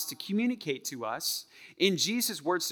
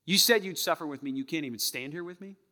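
ordinary talk, nothing marked

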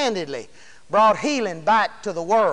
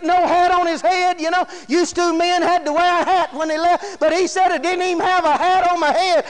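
impassioned, higher-pitched preaching; 5 semitones higher than usual. Real, not acted. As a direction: preach with full conviction, voice held up. Preaches at full force.

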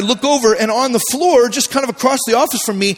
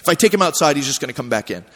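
much lower, softer, plain-spoken foreman; low soft foreman explaining the rule; calm matter-of-fact foreman; 10 semitones lower, 6 dB quieter, faster than usual. Retold or imitated. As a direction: explain it flatly to the upset coworker. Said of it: "he's like".

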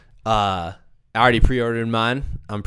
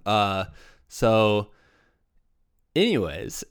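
usual speaking voice

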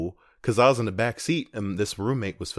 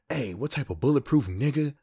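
show character; 6 semitones higher, 3 dB louder than usual. Retold or imitated. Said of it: "they was like".

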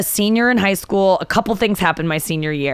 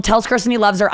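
ordinary talk, nothing marked

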